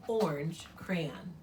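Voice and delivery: slow whisper; slow sly whisper; whispered sign-off. Acted. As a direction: breathe the name slowly like a secret. Signs letter in whisper.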